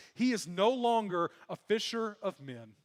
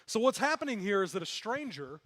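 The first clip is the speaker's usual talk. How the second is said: usual speaking voice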